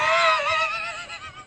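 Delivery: shrieking burst of laughter. Real, not acted. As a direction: crack up at your own line. Narrator cracks up at joke.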